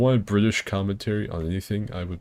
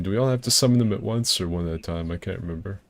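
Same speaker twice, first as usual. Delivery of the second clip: normal talking voice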